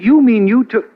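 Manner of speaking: stunned that she took it; astonished. Acted. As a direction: caught off guard, voice jumps up. Astonished Margot took the note.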